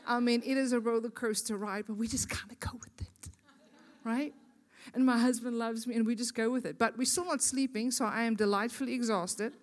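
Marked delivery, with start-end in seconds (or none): 1.9-4.3 unvoiced whispered aside. Real, not acted. Speaker to the women's gathering, confiding in a whisper.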